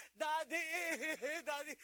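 put-on high wailing child's voice, loud; hammed-up sobbing kid calling daddy; crying child. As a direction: wail like a little kid running home in tears. Child crying to father in story.

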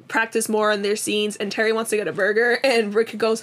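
usual speaking voice

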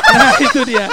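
high squeal of laughter; laughing. Real, not acted. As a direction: laugh out the words. Bursts out laughing at joke.

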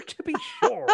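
very high mock Irish voice; hammed-up stage Irish squeal; Irish accent. Stage Irish catchphrase, far.

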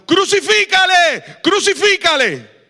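slow, loud, high angry chant; mob demanding. Retold or imitated. Preacher voicing the mob turning on Jesus, angry shout. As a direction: demand his death like a furious mob. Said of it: "unos días después gritaron".

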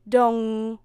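long, loud monotone dong; clock chime. A narrator's reading. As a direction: ring out like a striking clock. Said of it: "went the clock".

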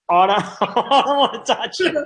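high, laughing protest; flustered laughing refusal. Retold or imitated. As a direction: laugh through the refusal, caught off guard. Flustered self quoted, laughing.

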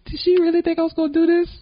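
high-pitched mock disbelief; incredulous quote. Actor retelling his reaction to her offer, incredulous. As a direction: voice shoots up in disbelief at the very idea.

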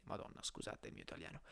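whispered under the breath; quick muttered apology to herself; muttered aside. Real, not acted. Mutters apology for own stumbling.

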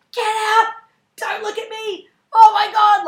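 high, loud panicked yell; the drunk date yelling from the bathroom, loud and high. Retold or imitated. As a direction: yell at her to get out, way up high. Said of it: "Like, yelling at me".

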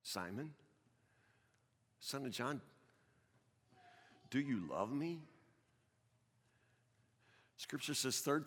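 soft, low, intimate reading of the dialogue; hushed storytelling. Acted. Preacher retelling Jesus questioning Simon, hushed and soft. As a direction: draw them in quietly to the tender scene.

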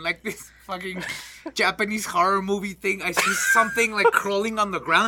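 ordinary delivery

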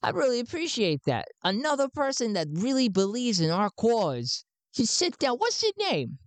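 an excited recruiter greeting a new believer; high-pitched Garn. Acted. Garn welcomes Sven into the cause, pitched high. Garn's voice.

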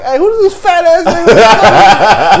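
high, loud, laughing challenge; mock aggressive shout. Buddies hopping out at the civilian, mock shout. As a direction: yell it as if spoiling for a fight.

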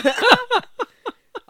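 loud high burst of laughter. Real, not acted. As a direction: let out a big laugh.

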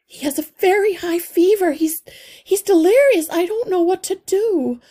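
high, loud, hurried and close to panic; frantic mother mouse. A narrator's reading. Mother mouse telling the healer her son is delirious, high and panicked. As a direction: pour out the fear for your sick child. Mother describes son's fever.

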